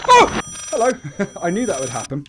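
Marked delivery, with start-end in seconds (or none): 0.1-0.4 very high, loud startled yelp; sudden piercing "oh" of fright; startled shriek. Real, not acted. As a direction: shriek at a jump scare. Jump scare in game.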